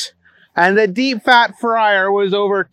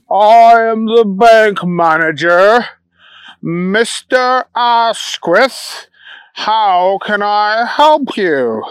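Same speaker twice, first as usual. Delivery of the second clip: slow, formal, drawn-out manager voice; stiff bank manager; 3 semitones higher, 3 dB louder, slower than usual. Acted. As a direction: speak like a prim, proper manager.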